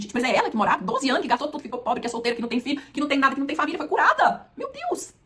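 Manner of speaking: rising, loud gossiping amazement; astonished bystanders. Acted. Crowd gasping at the healed woman, rising to a high cry.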